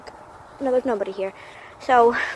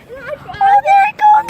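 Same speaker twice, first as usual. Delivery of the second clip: excited squeal; 7 dB louder than usual. Real, not acted. Bus ride starts shaking.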